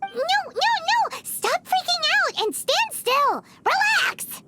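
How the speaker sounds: exasperated shouting. Acted. Paimon shouting at panicked Meng.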